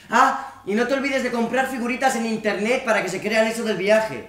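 loud, high impression of his mother; nagging mother. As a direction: imitate a mother shouting reminders. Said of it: "Dice que tengo que estar preparado".

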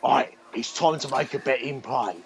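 lightly voiced betting ad pitchman; a hint of a gruff London ad read; mild Ray Winstone impression; Ray Winstone advert. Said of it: "he goes".

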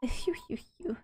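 breathy blowing. Acted. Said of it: "blowing on hot pastry, breath".

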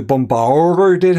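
"bombarded" with a big, drawn-out R; exaggerated big R. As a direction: lean hard on the R in "bombarded", mocking the accent. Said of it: "He does a big R on that".

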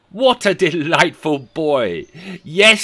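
slow, high, loud relish; exuberant delight. Acted. Scrooge praising the boy outside, delighted. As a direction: savour it, then burst out.

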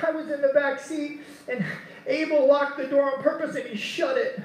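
put-on high, whiny child voice as a tattling daughter; whining little girl. Daughter Delilah complaining to her dad, high and whiny. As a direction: play the wronged little one tattling. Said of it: "she's whining".

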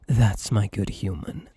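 ordinary talk

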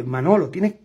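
usual speaking voice

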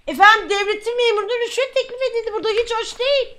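high, hurried formal complaint; indignant report. Pretend. Officer reporting a bribe to superior, indignant report. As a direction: rush out an outraged report.

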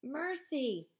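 a weak plea from below; faint cry for mercy. Acted. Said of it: "the same cry".

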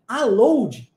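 Brazilian-style "Aloud", OH diphthong and extra final -djee; Portuguese-pattern mispronunciation. Shown as a demonstration. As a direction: sound out "Aloud" as if it were a Portuguese word. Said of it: "é pronunciado aloud".

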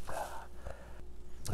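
breathless whispered uh; barely voiced through the burn; whispered through chili pain. Real, not acted. Pain from hot pepper.

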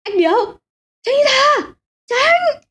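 very high desperate scream of warning; screaming hoarse. Acted. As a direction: shriek at him to move. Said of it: "Gã phu xe gào đến cháy cả cuống họng".

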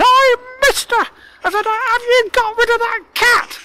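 very high, squeaky troll, calling out firmly; insistent little voice. A narrator's reading. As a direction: repeat it in a tiny, loud squeak. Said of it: "again the little voice said".

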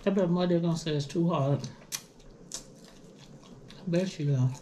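gnawing on a bone; talking while chewing. Real, not acted. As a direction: lips around food. Seen 2: Holds wing bone at lips, nibbling it while speaking.

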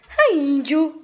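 loud, high greeting to a dog; excited pet talk. Real, not acted. Girl invites her dog to play, loud high pet talk. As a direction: get the dog keen to play, voice up.

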